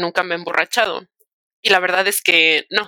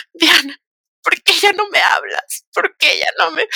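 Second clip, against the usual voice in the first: put-on drunk friend; 9 semitones higher than usual. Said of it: "me marca súper peda la chava".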